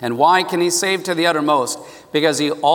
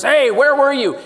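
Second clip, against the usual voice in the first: higher, louder mock cry to the sky; angry cry; 8 semitones higher, 4 dB louder than usual. Holly crying out to God, angry and louder. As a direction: hurl the question at the universe. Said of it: "I'm angry, I'm crying out".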